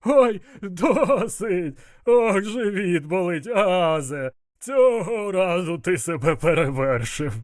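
high, drawn-out helpless wail; gasping with laughter. A narrator's reading. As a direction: beg for mercy as if laughter hurts. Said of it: "задихаючись, прохрипів він". (протяжно, знемагаючи від сміху)

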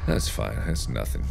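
low, soft, playing it cool; feigned nonchalance. Player shrugging off a scare, low feigned calm. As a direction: brush it off in a low, small voice.

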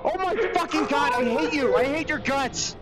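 high-pitched furious screaming; panicked screaming. Real, not acted. Player cursing the one who trapped him, high frantic screaming. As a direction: scream your hatred, voice shooting up high. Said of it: "You screamed like a little bitch".

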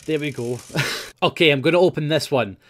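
ordinary talk, nothing marked

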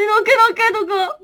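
high, loud mock-crying puppet voice; crying yokai plush. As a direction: play the hurt little yokai. Voicing the plush. (高い声で泣きそうな妖怪のぬいぐるみ)

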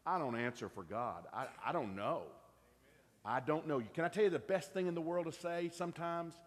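ordinary talk, nothing marked

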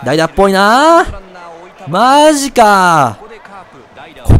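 slow, long-drawn last vowels; dismayed drawl. Real, not acted. Player seeing a pinch hitter coming, dismayed drawl. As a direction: stretch it out in disbelief.

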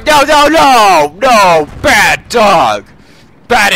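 slow, loud dog-scolding. Pretend. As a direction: drag out the scolding.

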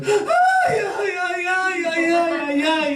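high excited ay-ay-ay, tapering off; mock joyful wail. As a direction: squeal with hammed-up delight at the money. Woman overjoyed at gift.

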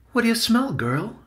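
put-on high-pitched boy talking to his pet; asking a pet. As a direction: start high, coax the little animal with a question. Benny questions his pet mouse.

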